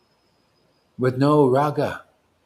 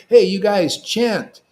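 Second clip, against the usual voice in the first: louder, slow urging command; rally the crowd to chant; rallying call; 6 semitones higher, 8 dB louder than usual. Retold or imitated. Guru urging devotees to chant, loud. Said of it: "That's why I said".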